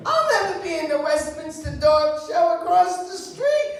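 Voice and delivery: high, loud whiny dog voice; hammed-up complaining greyhound; talking dog. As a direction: whine like a starving greyhound. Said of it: "the dog says to me".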